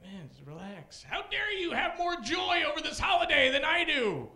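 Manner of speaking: mock angry rant. Comic imitating an outraged holiday hater, shouting. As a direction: rant like a petty killjoy. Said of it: "That was my impression".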